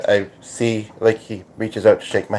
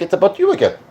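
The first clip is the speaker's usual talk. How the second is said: put-on raised pitch, borrowed accent; accent verging on British-Australian, pitched up; 7 semitones higher, faster than usual. Voicing his remark that it's about her, put-on accent. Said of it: "I'm not doing the accent".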